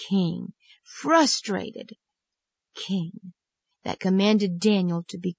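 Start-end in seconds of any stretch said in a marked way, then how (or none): none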